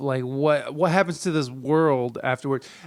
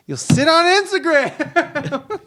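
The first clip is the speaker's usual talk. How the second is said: much higher, slow, laughing taunt; mocking singsong; 12 semitones higher, 6 dB louder, slower than usual.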